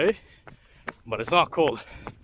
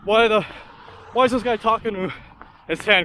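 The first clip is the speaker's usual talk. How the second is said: mock baffled onlooker, higher and louder; puzzled passerby; 5 semitones higher, 6 dB louder than usual. Runner mimicking drivers who see him filming himself, mock bewildered. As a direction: imitate drivers wondering at you, pitched up. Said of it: "driving by me and saying".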